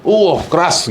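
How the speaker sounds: lightly voiced startled remark; mock remark. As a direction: exclaim at someone's harshness. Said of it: "yang kayak ngomong tuh". (sedikit nada kaget yang dibuat-buat)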